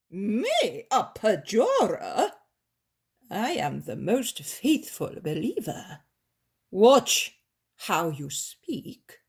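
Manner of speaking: offended master. Acted.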